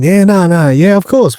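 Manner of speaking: high, brushing-off "yeah of course"; mock dismissive agreement. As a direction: wave it away like it's nothing. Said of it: "Do you know what I mean?".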